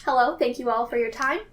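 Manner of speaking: ordinary delivery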